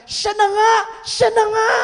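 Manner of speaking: high, loud excited cry; excited shout. Retold or imitated. Daughter realizing the pastor is the one, shouting high. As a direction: shout it out in recognition.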